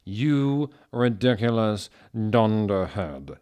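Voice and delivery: slow, drawn-out sneer; hammed-up Snape. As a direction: sneer it like the potions master. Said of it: "Snoop yielded".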